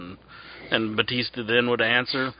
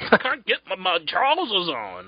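mock Becky Lynch accent; 6 semitones higher than usual. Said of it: "She has that crazy accent".

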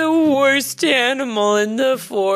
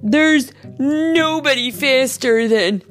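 slow, loud, high boast; self-satisfied coyote showing off; bragging; 7 semitones higher, 6 dB louder than usual. A narrator's reading. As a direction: draw out the brag. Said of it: "Coyote continued".

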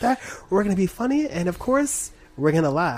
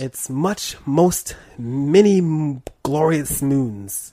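put-on Borat accent; 4 dB louder, much slower than usual. Broken English Borat bit.

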